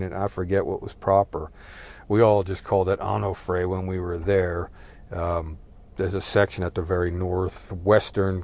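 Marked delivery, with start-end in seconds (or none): none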